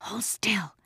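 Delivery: put-on soft, high girl's order; urgent and secretive; hushed command. A narrator's reading. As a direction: command her under your breath. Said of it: "Anne-Marie commanded".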